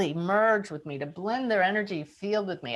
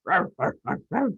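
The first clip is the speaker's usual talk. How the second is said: big dog bark. Retold or imitated. A guest hears a pit bull barking behind the door, mock barks. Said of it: "big, big dog voice".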